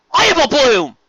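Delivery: high, loud frantic scream. A narrator's reading. Applejack screaming to her lost sister, high and loud. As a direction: scream it in relief and fear. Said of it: "Applejack screamed".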